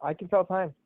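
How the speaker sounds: ordinary delivery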